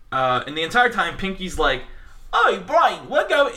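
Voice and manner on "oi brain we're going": high-pitched cartoon idiot voice; Pinky. Host showing a co-host how the cartoon mouse talks, high impression. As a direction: squeal it like a daft cartoon lab mouse. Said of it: "this is how Pinky actually talks".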